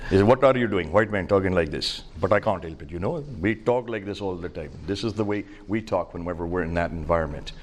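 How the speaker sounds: Indian-accented English, demonstrating how they talk back home. Shown as a demonstration. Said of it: "when I talk to Indians, I talk like this".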